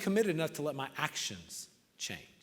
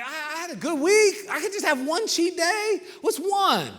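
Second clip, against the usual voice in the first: much higher, coaxing self-talk; mock wheedling voice of temptation, much higher; tempted inner voice; 10 semitones higher, 3 dB louder than usual. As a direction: talk yourself into a cheat day, high and wheedling. Retold inner voice wanting cheat day.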